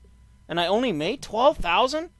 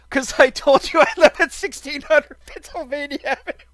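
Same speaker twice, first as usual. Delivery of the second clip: laughing; 6 semitones higher, 5 dB louder than usual. Real, not acted.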